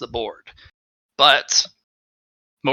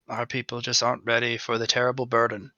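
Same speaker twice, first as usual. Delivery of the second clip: ordinary delivery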